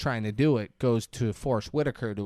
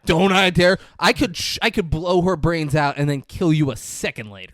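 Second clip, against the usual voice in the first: loud, higher-pitched mock villain outburst; retold menacing threat; 4 semitones higher, 7 dB louder than usual. Villain on phone threatens to kill Kelly, with loud mock menace.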